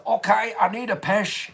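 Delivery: loud, high attempt at Scottish; hammed-up fake Scottish; bad Scottish accent. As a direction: belt it out in a dodgy Scottish accent. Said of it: "can't even do a good Scottish accent".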